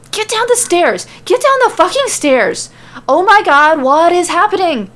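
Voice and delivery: very high, loud frantic yell. Acted. Sammy yells at Cesaro and Shinsuke down the stairs. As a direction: scream orders in panic. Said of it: "hear him yelling at Cesaro".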